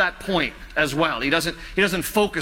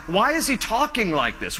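ordinary talk

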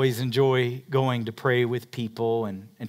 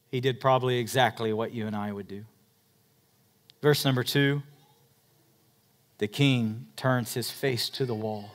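ordinary talk, nothing marked

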